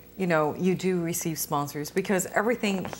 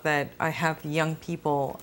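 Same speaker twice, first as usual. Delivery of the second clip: usual speaking voice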